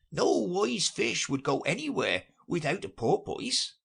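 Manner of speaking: high, odd creature voice; a fussy sea creature lecturing; Mock Turtle. A narrator's reading.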